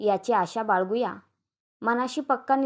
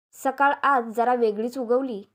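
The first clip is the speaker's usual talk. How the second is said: usual speaking voice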